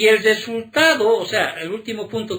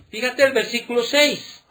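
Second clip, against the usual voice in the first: ordinary delivery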